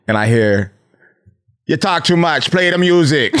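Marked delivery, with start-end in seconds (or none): 1.7-3.3 put-on Jamaican accent. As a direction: mimic her Caribbean lilt in a Jamaican accent. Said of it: "Why you make her Jamaican?".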